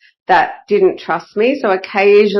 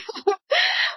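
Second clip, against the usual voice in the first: a high squeal of a laugh, much higher pitched; 8 dB quieter than usual. Real, not acted. Coach laughing at how easy the return is, high squealing laugh.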